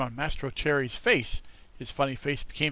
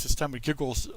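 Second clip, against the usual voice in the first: usual speaking voice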